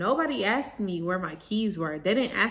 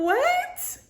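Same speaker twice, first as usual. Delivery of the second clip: very high, rising squeak of disbelief; baffled disbelief. Retold or imitated. Bride baffled by the bridesmaid's complaint, incredulous. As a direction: re-enact being utterly thrown.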